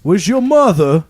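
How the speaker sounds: loud and high, sliding from Cockney toward Southern; a guard talking down to a lost child; shifting Cockney guard. Acted. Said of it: "Cockney to Southern gentleman".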